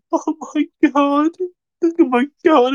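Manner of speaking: ordinary talk, nothing marked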